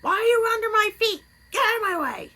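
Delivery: high, loud mock scold; mock scolding cats. Woman imitating herself scolding her cats, high and loud. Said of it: "I get mad and I'll stomp".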